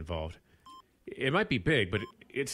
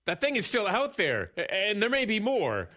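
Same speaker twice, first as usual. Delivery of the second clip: higher, pressing warning; urgent plea; 10 semitones higher, 3 dB louder than usual. Acted. Ranger urging his boss that more may come. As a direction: push hard, alarmed, to be heard.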